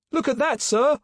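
very high, urgent call; lookout's yell. A narrator's reading. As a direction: yell in alarm to the officer. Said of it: "the starboard lookout yelled".

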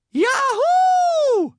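very high, loud whooping yell; joyful yell. A narrator's reading. SpongeBob just chosen as hall monitor, yelling. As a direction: let out a long, piercing victory whoop. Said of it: "yelled SpongeBob".

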